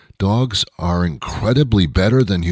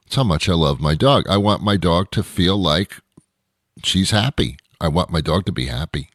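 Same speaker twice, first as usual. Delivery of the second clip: ordinary talk, nothing marked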